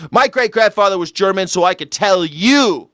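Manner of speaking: lightly hammed-up sincere speaker; mock earnest heritage claim. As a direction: parody someone claiming authority from ancestry. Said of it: "I'm sure emotionally it works".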